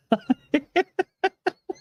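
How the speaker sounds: high-pitched giggling laugh. Real, not acted. Laughing between clips.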